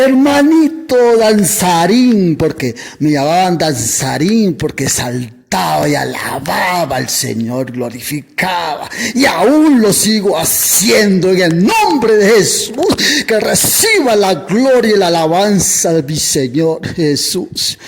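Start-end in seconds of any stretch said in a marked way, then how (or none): 0.0-2.4 raised, drawn-out greeting; louder, higher retold hail; hailing preacher friend. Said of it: "el varón viene y me ve". 9.1-17.8 raised, higher preaching voice; impassioned church exclamation; fervent praise. Real, not acted.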